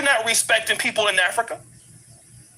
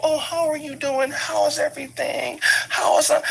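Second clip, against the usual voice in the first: imitating a two-faced official's sweet hello; fake humble greeting; 5 semitones higher than usual. Mocking the fake-humble seat holder with sweet politeness. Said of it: "that person's fake humble".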